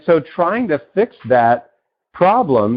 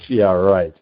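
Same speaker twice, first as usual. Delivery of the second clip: sceptical brain; 6 semitones lower than usual. Retold or imitated. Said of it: "And your brain says".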